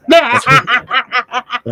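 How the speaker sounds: laughing. Real, not acted. Laughing at wife's blunt quote.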